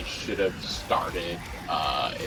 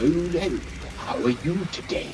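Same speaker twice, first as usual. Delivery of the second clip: lightly voiced storyteller, much higher; sing-song storybook narrator greeting; 9 semitones higher, 3 dB quieter than usual. Shown as a demonstration. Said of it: "sometimes I do my narrator voice".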